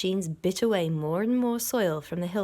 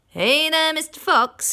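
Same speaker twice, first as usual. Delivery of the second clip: higher, louder taunting yell; 9 semitones higher, 10 dB louder than usual. A narrator's reading. Bunce yelling a taunt at Mister Fox. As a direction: jeer down the hole. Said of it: "yelled Mister Bunce".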